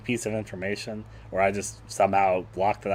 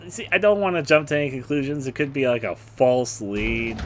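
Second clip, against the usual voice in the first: ordinary delivery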